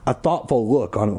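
plain reading voice

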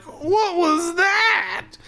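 a hammed-up cartoon panic wail; mock frightened cry. Steve hears creaking in the basement, mock terrified wail. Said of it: "so Steve was like".

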